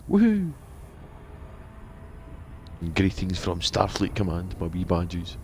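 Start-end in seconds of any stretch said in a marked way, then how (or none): 0.1-0.5 high, loud woohoo; mock cheer of Woohoo; shouted woohoo. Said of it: "Could you shout it?".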